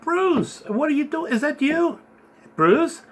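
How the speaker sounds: high, questioning call to a friend; retelling calling out to Bruce in the dream, high and puzzled. Retold or imitated. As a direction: call across the room, unsure it's him.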